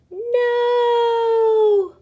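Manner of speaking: high, loud alarmed yell; a shrill panicked no; crowd yells. Acted. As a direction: cry out to stop her. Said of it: "the crowd yelled".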